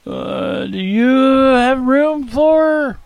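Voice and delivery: slow, high, trembling plea. Acted. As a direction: ask timidly, voice quivering. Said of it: "speak in a low, trembling voice".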